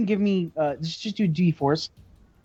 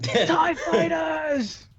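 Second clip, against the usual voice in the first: high, drawn-out mock Australian scream; Australian accent scream; 13 semitones higher, much slower than usual. Game master screams for the pilot, slow high Australian accent. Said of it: "in your, an Australian accent".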